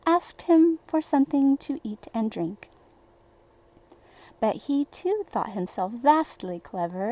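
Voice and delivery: usual speaking voice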